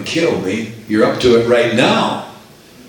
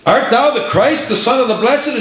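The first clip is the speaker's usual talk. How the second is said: high priest questioning; 9 semitones higher than usual. Acted.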